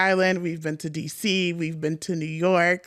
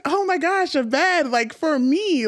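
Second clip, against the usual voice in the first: high excited oh my gosh; thrilled to see a bed; delighted gasp; 8 semitones higher than usual. Retold or imitated. Tired guest seeing a bed, high delighted gush. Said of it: "So I was like".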